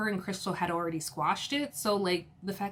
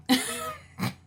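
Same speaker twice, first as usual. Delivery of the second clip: high laugh. Real, not acted. Laughing at Erica's reply.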